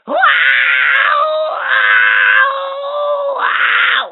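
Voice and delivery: high, loud thrilled wows; thrilled shouts. Acted. Toy being swung around by the child, shouting with glee. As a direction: shout with delight while spun.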